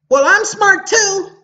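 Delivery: high, slow whiny mock voice; hammed-up whiny retort; mocking a know-it-all. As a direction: parody a smug person being childish. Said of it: "I actually had somebody tell me that".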